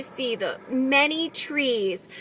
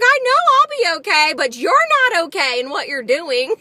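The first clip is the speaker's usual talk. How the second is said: high, loud comeback, dropping at the end; angry retort; 5 semitones higher, 4 dB louder than usual. Retold or imitated. Wheelchair user answering rude teenagers, indignant. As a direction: retell the retort with real heat. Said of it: "I was really angry".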